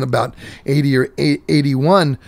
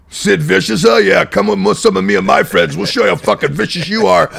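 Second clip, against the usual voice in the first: lightly voiced biker threat, higher; hint of a menacing old biker; tough biker dad; 6 semitones higher, 3 dB louder than usual. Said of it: "my old man going".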